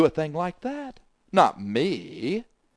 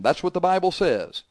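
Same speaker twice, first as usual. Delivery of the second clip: ordinary delivery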